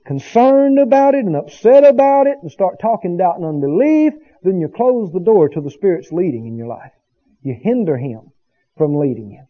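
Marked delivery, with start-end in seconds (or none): none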